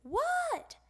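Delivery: Froggy calling back to his mother; high reply. Acted. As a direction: answer from far off. Froggy answers the call.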